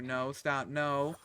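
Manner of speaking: ordinary talk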